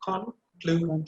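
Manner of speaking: drawn out, syllables pulled apart; conclusion, slow and spaced. Shown as a demonstration. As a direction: sound out each syllable slowly. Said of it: "Can you try it again?".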